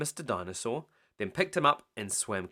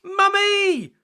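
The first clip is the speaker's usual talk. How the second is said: high, loud panicked call; shouting; 11 dB louder than usual. Acted. Peppa calling Mummy to save her snow castle, high shout. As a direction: yell for help. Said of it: "cried Peppa".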